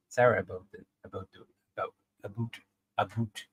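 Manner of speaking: practising Canadian "about" as "aboat", then "aboot"; tentative Canadian accent. Shown as a demonstration. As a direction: try out Canadian vowels, softly. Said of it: "do a Canadian accent".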